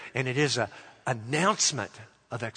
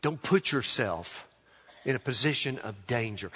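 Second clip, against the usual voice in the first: ordinary delivery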